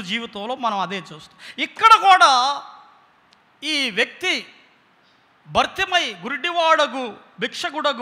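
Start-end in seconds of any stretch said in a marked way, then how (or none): none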